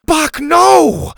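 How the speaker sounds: very high, loud cry; panicked kid yelling; alarmed cry. A narrator's reading. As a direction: cry out to stop a pet. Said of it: "Bo cried".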